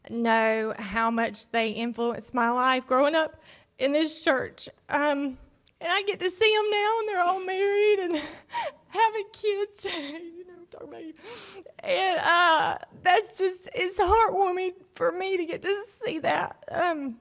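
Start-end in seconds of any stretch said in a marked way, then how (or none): 5.8-17.2 tearful, voice high and breaking; fighting back tears, pitch pushed up; holding back sobs. Real, not acted. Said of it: "I'm trying not to cry".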